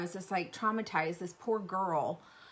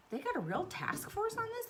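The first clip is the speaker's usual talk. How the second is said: incredulous; 12 semitones higher, 4 dB quieter than usual. Real, not acted. Incredulous at task force.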